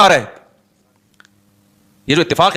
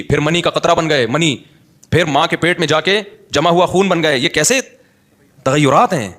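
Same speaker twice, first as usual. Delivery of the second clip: normal talking voice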